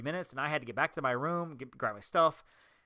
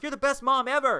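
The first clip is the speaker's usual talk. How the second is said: high, loud gushing praise; retold cheering; 10 semitones higher, 6 dB louder than usual. Clapping crowd praising a mom, excited cheer. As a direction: cheer it out in a raised voice.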